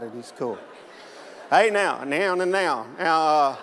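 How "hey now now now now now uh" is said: put-on Arkansas guy greeting the pastor in his backwoods accent. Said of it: "he spoke banjo".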